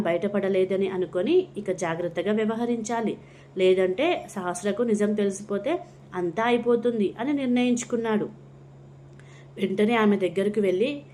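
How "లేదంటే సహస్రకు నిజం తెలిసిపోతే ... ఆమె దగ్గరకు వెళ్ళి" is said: ordinary delivery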